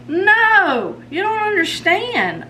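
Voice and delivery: mock teen protest. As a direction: wail it like a misunderstood teen. Daughter protesting, mom imitates her.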